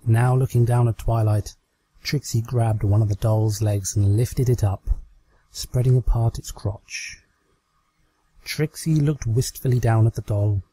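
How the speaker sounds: ordinary delivery, nothing marked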